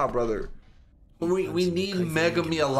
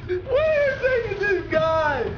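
high, slow yell at the screen; stretched high shout at the fight; long shout; 12 semitones higher, 4 dB louder, much slower than usual. Real, not acted. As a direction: drag it out in a shout.

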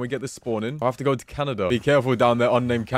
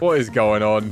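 normal talking voice

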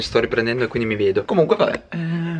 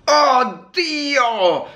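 disgusted; 12 semitones higher than usual. Real, not acted. Tastes disgusting food.

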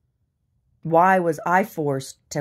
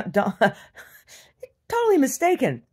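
laughing; 9 semitones higher, 4 dB louder than usual. Real, not acted. Laughing mockery.

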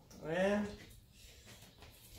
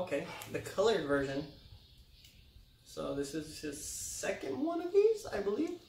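ordinary delivery

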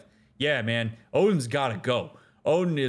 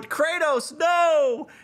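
high, loud pleading to a stubborn hero; high-pitched fan begging Kratos to stay; pleading mock protest; 13 semitones higher, 4 dB louder, slower than usual. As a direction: beg him not to go, hammed-up. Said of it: "we spent so much time going".

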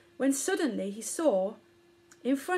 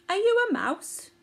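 high, hopeful little voice; childlike and unsure; young elephant voice; 10 semitones higher, 5 dB louder than usual. A narrator's reading. Poppet asks the mouse.

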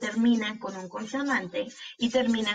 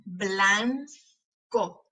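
"Blanco" slowly as blan, pause, co; split into syllables. Shown as a demonstration. Teacher showing the tongue closing, syllable by syllable. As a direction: separate the two syllables of "Blanco". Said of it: "para ver cuáles de esas sílabas".

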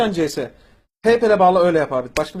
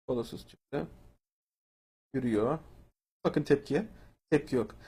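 no reaction; 6 semitones lower, 12 dB quieter than usual. Shown as a demonstration. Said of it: "bakın tekrar ediyorum".

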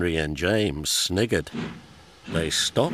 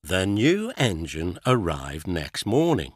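usual speaking voice

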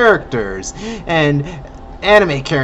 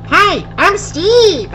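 high, slow squeaky little voice; squeaky little guy; 13 semitones higher, slower than usual. Acted. Little person inside the mug, squeaky and slow. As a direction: pop out tiny and squeaky. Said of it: "Little person pops out like".

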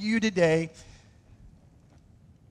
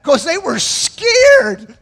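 much higher and louder, drawn out slowly; emphatic slow exclamation; 10 semitones higher, 9 dB louder, much slower than usual. Real, not acted. Preacher to congregation about scared disciples, high slow emphasis. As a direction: stretch it out with big emphasis.

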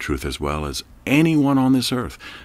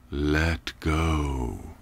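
low, calm command; 4 semitones lower, 5 dB quieter than usual. Acted. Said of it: "the voice repeated".

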